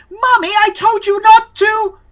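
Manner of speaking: put-on very high child calling to Mommy; high-pitched, anxious boy voice; upset little boy. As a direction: go from loud protest to worried calling. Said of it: "He's like".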